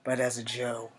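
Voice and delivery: put-on measured, earnest young soldier with long pauses between phrases; Charlie Iron Knife. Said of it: "I'm going to be a character from G I Joe named Charlie Iron Knife".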